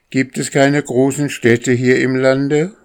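high, loud repeated question; calling down. A narrator's reading. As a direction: call it out to someone far below. Boy repeats his called question.

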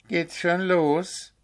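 a hint of a groggy high question, slow; dazed. A narrator's reading. The owl waking up, lightly dazed. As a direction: ask as if just woken, slowly. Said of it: "fragte er halb benommen".

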